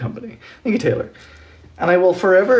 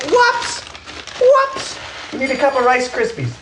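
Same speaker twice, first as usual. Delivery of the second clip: mock high squeal; 8 semitones higher than usual. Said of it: "I was like".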